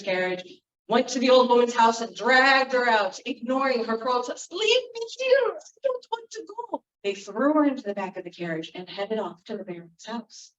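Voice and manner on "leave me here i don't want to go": put-on high, panicked old woman; pleading not to be taken; frightened protest. Old woman protesting to the manservants, high and scared.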